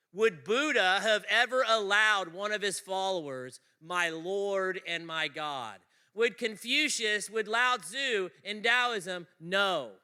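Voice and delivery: loud, high sermon delivery; booming pulpit preaching; full-force preaching. Acted. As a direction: drive the point home to the room.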